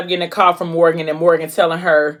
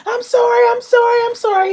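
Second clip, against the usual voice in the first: high, squealing mock panic; imitated screaming plea; 4 dB louder than usual. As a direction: squeal rapid-fire apologies in a very high voice. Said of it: "So now she's screaming".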